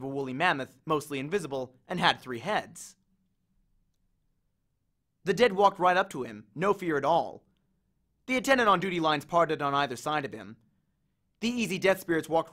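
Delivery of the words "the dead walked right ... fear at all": plain reading voice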